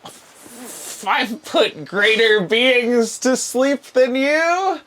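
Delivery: high, slow, hammed-up villain voice; defiant sorcerer. Game master's sorcerer answers surrender demand, high theatrical defiance. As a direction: taunt them in a high, drawn-out sorcerer voice. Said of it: "He's like".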